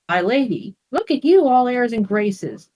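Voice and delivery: mock her airs; mocking bark. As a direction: Uriel mocking Sarah's new title. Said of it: "barked Uriel".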